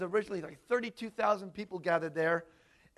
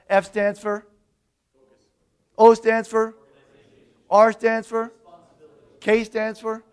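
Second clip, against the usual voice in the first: loud call to the crowd; 10 dB louder, slower than usual. Real, not acted. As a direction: shout the letters, wait for answer. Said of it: "preacher calls out for audience response".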